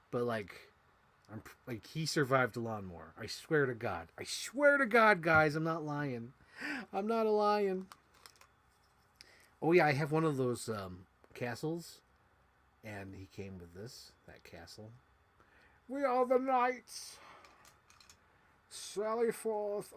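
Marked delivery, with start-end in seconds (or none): 4.2-7.9 high, slow pleading oath; pleading insistence. Real, not acted. Swearing the lawnmower story is true, high pleading oath. 15.9-19.9 high, slow theatrical knight. Acted. Playing knights with a castle toy, slow high theatrical call.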